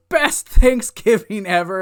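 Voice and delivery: loud, gloating imitation; hammed-up boast; mock gloating. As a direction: crow it like a smug winner. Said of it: "he's starting to gloat".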